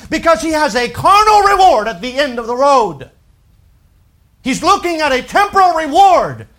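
loud, high, driving sermon; preaching at full force. Acted. Preacher urging his congregation, loud and high. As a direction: hammer the point home to the pews.

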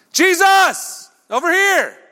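very high, loud holler; screaming. Acted. Two guys at the roadside calling Jesus, screaming. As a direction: yell across a crowd for attention. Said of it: "start screaming".

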